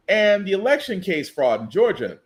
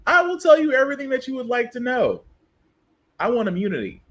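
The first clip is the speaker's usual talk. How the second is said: quick mock English accent, pitched up then settling; 5 semitones higher, faster than usual. Commentator voicing Miss Maxwell asking for immunity, mock English accent. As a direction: play her with a put-on English accent. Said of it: "why I gave her an English accent".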